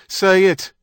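high, loud command; sharp demand. A narrator's reading. Kate pressing Stephen for his approval, commanding.

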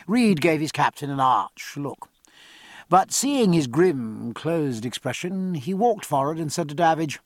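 ordinary delivery, nothing marked

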